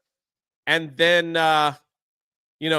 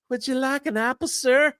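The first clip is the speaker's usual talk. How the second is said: higher, dainty princess offering fruit; lightly voiced sweet maiden, higher; 8 semitones higher than usual.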